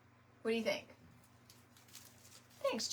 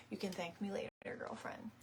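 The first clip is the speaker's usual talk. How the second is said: whisper; 8 dB quieter, faster than usual. Real, not acted.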